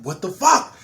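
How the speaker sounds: alarmed loud outburst; panicked yell. Retold or imitated. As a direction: shout it as the flame comes back. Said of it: "I'm like".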